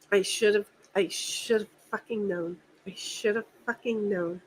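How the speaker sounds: soft, low and beaten, real; defeated mutter. Real, not acted. To the stream friends about the contractor, defeated and quiet. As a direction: sink into it, barely voiced. Venting, life feels done.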